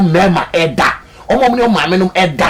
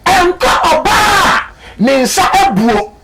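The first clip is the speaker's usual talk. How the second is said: "nkɔ ɔba a ne nsa ebuo" drawn out slowly and shouted high; slow shouted emphasis; 9 semitones higher, much slower than usual. Acted. Preacher echoes verse emphatically.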